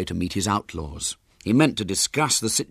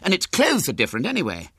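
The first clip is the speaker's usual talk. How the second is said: put-on high-pitched, emphatic boy insisting; vehement protest; 9 semitones higher, 4 dB louder than usual. A narrator's reading. Henry insisting the baby isn't theirs, vehement protest. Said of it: "protested Henry vehemently".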